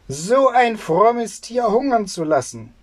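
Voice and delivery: high-pitched indignant scolding; angry cry. A narrator's reading. Tailor outraged that the goat went hungry, crying out indignantly. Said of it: "schrie der Schneider".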